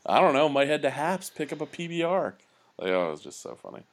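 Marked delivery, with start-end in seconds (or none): 0.1-2.3 laid-back bro, pitched up; breezy, like nothing's wrong; casual bro voice. Retold or imitated. Buddy voiced, casual plans.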